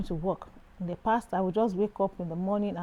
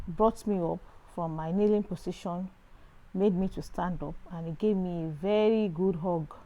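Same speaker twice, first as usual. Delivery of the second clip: ordinary talk, nothing marked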